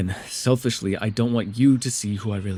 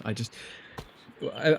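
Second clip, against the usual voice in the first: ordinary talk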